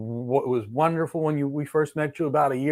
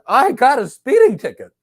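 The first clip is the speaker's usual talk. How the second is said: higher and louder, hammed-up worry; mock exasperated client; 10 semitones higher, 10 dB louder than usual. [name] as the client, blurting his speeding ticket to the lawyer, mock worry. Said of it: "And I said".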